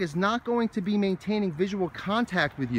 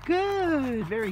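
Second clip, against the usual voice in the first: 1 praise; 9 semitones higher, 4 dB louder, much slower than usual. Real, not acted. Praising dog.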